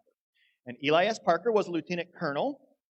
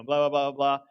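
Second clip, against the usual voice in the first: normal talking voice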